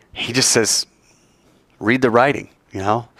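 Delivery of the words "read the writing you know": ordinary delivery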